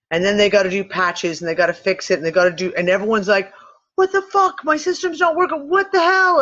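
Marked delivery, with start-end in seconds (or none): 4.0-6.4 high-pitched exasperated user rant; much higher, worked-up mock complaint; mock outraged screaming. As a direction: imitate furious customers yelling at a company. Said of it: "scream at the software company".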